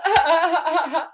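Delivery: laugh at own video. Real, not acted. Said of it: "I'm like".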